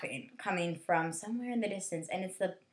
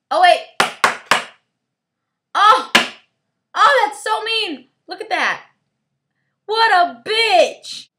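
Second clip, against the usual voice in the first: high, loud indignant exclaiming; scandalized yelling; outraged outburst; 10 semitones higher, 14 dB louder, slower than usual. Real, not acted. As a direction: cry out in affront, stretching the last words. Outraged reaction to something seen.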